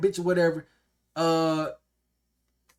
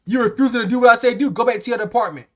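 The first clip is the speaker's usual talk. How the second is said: higher and louder, scolding; mock the boss snapping orders; angry boss; 6 semitones higher, 7 dB louder than usual. Boss telling him to go back to his department, loud scolding. Boss yelling at worker again.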